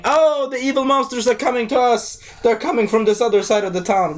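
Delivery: mock scream. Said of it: "he was, like, screaming".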